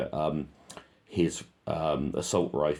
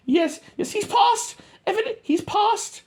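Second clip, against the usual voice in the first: much higher, louder mock radio talk; squeaky radio voice; 6 dB louder, slower than usual. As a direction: mimic a soldier on the radio, squeaky.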